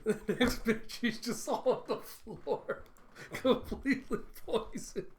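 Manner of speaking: high, helpless laughter through the words; real laughing fit. Real, not acted. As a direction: struggle to get the words out while cracking up. Teller cracks up at the punchline.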